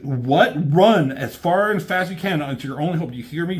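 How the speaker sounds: high, loud urgent pleading; frantic urging. Retold or imitated. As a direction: urge him to flee. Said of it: "And he's like".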